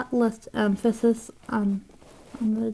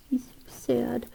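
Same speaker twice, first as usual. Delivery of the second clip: softer, whispered aw-so-sad; sympathetic whisper; 2 semitones higher, 8 dB quieter than usual. Real, not acted. Cooing at the sad character she drew, soft whisper. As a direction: coo it under your breath in pity.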